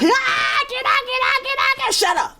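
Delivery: high, loud frantic pleading; panicked scream. Retold or imitated. The beaten attacker screaming to get out, a high panicked shriek. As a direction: scream to be let go.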